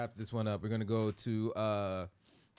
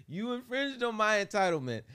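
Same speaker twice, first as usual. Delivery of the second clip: higher, louder mock of an entitled woman; now ham her up, indignant and shrill-free but pushed higher; 9 semitones higher, 4 dB louder than usual. Host hamming up the entitled woman, higher and louder.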